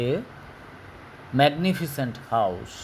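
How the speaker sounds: magnificent house drawn out; slow reading. Shown as a demonstration. Teacher reading a textbook sentence slowly to pupils. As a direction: stretch the words out slowly.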